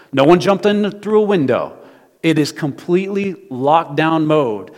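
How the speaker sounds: loud preaching in short, separate bursts; preaching at full force. Acted. Preacher stressing there was no way in, forceful preaching. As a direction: drive each point home to the congregation.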